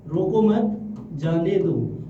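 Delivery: slowly, "रोको मत", pause, "जाने दो"; pause after मत. Shown as a demonstration. Teacher demonstrating comma placement to students, pause after मत. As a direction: hold a comma's gap after मत. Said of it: "और comma लगा दिया यहाँ".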